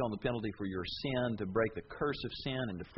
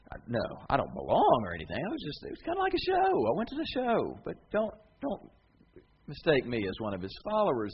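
flustered, pitch jumping higher; defensive denial; 5 semitones higher than usual. Pretend. A follower denying Jesus, flustered and defensive. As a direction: squirm out of it.

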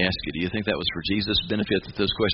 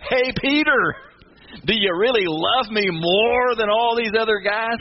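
higher and louder, slow and probing; Jesus confronting Peter, slow pointed challenge; 11 semitones higher, 4 dB louder, slower than usual. Retold or imitated. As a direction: press Peter hard on really. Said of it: "let's just get right to the heart of the matter".

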